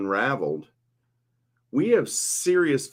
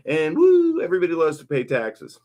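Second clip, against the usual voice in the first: high, loud mock woo, then sarcastic; sarcastic cheer; 5 semitones higher, 4 dB louder than usual. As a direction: fake enthusiasm about paying taxes. Sarcastic cheer about taxes.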